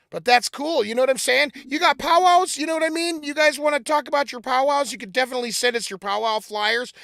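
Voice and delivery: wired, loud and jittery; buzzing on too much coffee; too much caffeine. Real, not acted. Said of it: "did I drink two cups of coffee today?".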